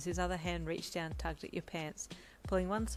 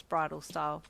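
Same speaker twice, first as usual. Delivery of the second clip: ordinary delivery, nothing marked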